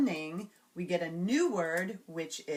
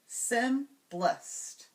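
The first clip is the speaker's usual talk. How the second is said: SIMPLEST slow, in two separated beats; syllable by syllable; 4 semitones higher than usual. Shown as a demonstration. Teacher giving the changed word, simplest slowly split. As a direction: break simplest into sim, plest. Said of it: "change the final syllable of".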